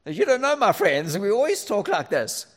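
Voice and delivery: loud, high indignant comeback; retelling his reply to Kath, loud and high; defensive retort. Retold or imitated. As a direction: snap back at your wife. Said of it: "And it's like".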